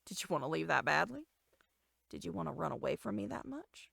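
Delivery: usual speaking voice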